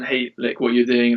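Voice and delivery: ordinary talk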